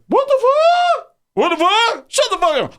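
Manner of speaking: very high squawking caricature of anger; mock outraged comeback. Man yells back after being bumped, mock squawking outrage. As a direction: squawk it far above your own voice. Acts out other man's angry reply.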